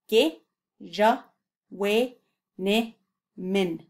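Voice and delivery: each syllable set apart with a pause; broken into syllables. Shown as a demonstration. Teacher splitting the word into syllables for the learner. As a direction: spell Ge, ja, wai, ni, min out beat by beat. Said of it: "syllable by syllable".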